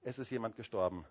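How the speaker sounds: low, soft, sorrowful reply; understated grief, voicing a mournful wife; retold very sad. Wife answering Luther's question about a death, sadly. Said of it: "sie sagte ganz traurig".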